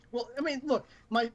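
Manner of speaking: ordinary delivery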